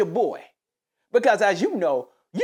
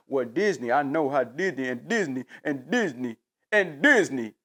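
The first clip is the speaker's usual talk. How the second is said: mock glazing; 7 semitones lower than usual. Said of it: "You got so much glaze".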